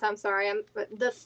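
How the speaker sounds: normal talking voice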